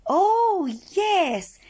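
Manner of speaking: excited praise. Acted. Praising children's answer.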